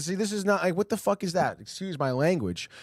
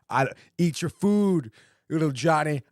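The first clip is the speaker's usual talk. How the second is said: mock mom; 3 semitones higher than usual. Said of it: "talking to your mom about, like, the eggs".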